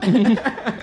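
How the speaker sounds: laughing at himself. Real, not acted. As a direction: burst of laughter. Laughs after accent.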